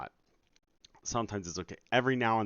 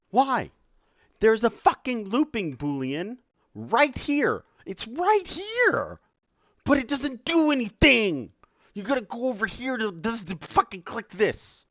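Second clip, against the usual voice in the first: furious rant; 10 dB louder than usual. Real, not acted. Dev yelling at the looping setting, furious high rant. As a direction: scream it at the screen.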